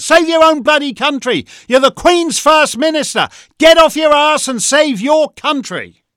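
loud, high angry tirade; shouting in real exasperation; worked-up rant. Real, not acted. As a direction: really let rip at him, furious. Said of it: "I'm getting too worked up".

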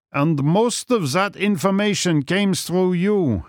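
put-on high German-accented dismay; agitated German villain; dismayed cry. A narrator's reading. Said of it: "he cried".

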